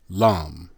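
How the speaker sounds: "lam" with an ah vowel, pitched low; "lam" as one low, isolated syllable; blended syllable. Shown as a demonstration. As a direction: sound out "lam" as "lahm". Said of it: "which is an ah sound".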